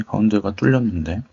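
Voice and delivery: normal talking voice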